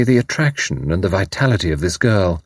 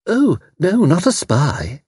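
very high, protesting voice; shocked guest; 9 semitones higher, slower than usual. Acted. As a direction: object in a high, dismayed voice.

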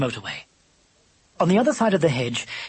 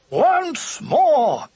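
high, loud heroic shout; rallying cry; 12 semitones higher, 5 dB louder than usual. A narrator's reading. Lubber charges the wire a second time, rallying cry. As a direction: hurl it out high before the charge. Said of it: "he cried".

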